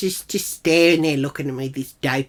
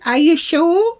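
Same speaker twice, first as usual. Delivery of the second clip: high, loud disbelieving query; put-on high incredulous voice; imitated doubting question; 13 semitones higher, 8 dB louder, slower than usual. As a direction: mimic her challenge, pitched right up. Imitating Jill doubting her.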